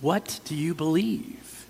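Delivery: soft, slow, searching question; slow quiet question. Shown as a demonstration. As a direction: let it sink in, quietly and slowly. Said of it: "How are you gonna say it?".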